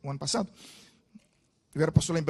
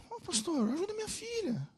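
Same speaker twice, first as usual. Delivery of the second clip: pleading father; 10 semitones higher, 7 dB quieter than usual. Retold or imitated. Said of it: "o pai pediu socorro".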